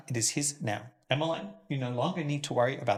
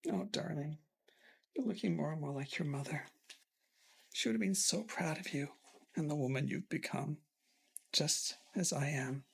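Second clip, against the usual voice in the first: tender and soft; 6 dB quieter than usual. Acted. Father tender to daughter.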